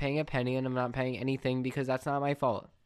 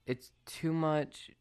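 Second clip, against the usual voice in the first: ordinary delivery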